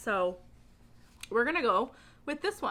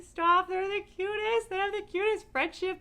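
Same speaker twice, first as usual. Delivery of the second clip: gushing fangirl squeal; 10 semitones higher, 5 dB louder than usual. Retold or imitated. Vlogger gushing about a cute friendship, high squeal. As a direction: squeal it like they're too adorable to bear. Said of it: "where I'm just like".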